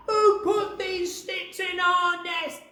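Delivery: very high, loud, slow furious bird yell; angry shout. Acted. Angry birds demand.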